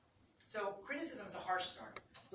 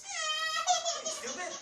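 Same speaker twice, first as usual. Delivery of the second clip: much higher, slow, sneering insult; mocking criticism; 7 dB louder, much slower than usual. Partner mocks spouse over the cutlery, high and sneering. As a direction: drag it out like a mocking jab. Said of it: "That's criticism".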